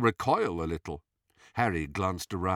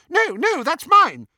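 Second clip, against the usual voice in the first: high, loud panicked denial; alarmed protest; 10 dB louder than usual. A narrator's reading.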